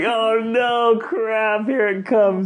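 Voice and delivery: hammed-up dread of the talk, half laughing; mock dread. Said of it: "I would get this sick feeling".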